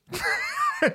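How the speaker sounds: sudden high laughing shout; real laugh that breaks out high; burst of laughter. Real, not acted. Laughing at the absurd story.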